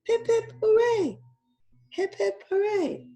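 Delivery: high squealing "Hip, hip, hooray"; high excited cheers; cheering. Acted. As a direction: cheer like a crowd at a party. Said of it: "three cheers for Dentist Dan".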